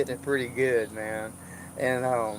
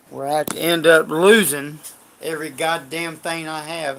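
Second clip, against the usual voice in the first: real bitter outburst; 3 semitones higher, 7 dB louder than usual. Real, not acted. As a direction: hammer each word like swearing it off for good. Bitter vow never to get burned again.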